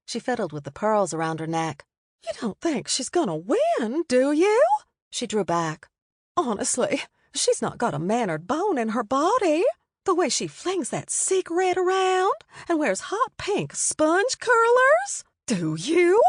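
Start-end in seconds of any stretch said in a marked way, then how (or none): 2.2-4.6 higher, rising into a louder scandalised question; a prim older lady clutching her pearls; fussy Southern matron. A narrator's reading. Mary Elizabeth voiced by narrator. 6.4-16.2 higher, rising into a louder scandalised question; a prim older lady clutching her pearls; fussy Southern matron. A narrator's reading. Mary Elizabeth voiced by narrator.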